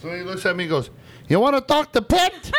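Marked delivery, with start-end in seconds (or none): 1.3-2.5 high, loud imitation of the dishwasher asking for Pete; imitating the dishwasher. Imitating the dishwasher asking for the owner, high and loud. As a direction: squeak it out like the confused dishwasher.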